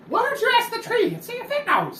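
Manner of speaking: very high, fast sardonic voice; mock him in a squeaky bird voice; high-pitched snarky creature voice; high kenku voice. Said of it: "looks at him and says".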